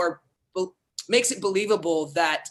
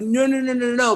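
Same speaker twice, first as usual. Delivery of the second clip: pleading no's, higher and a bit louder; earnest Desdemona; 6 semitones higher, 4 dB louder than usual. Retold or imitated. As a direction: beg him earnestly, pitch up. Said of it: "a kind of earnest".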